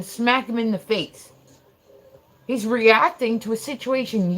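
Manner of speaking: loud, high-pitched scolding; retold furious telling-off; heated anger. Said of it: "I was madder than I've been".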